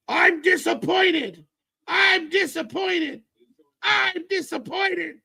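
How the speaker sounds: high, slow hammed-up scolding; mock disappointed dad. Parodying Jericho's angry dad speech, hammed-up scolding. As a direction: overdo the let-down father. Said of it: "the angry dad speech".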